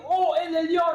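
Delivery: high, loud invocation. Acted. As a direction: call out to God at full voice. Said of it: "David est en train d'élever sa voix".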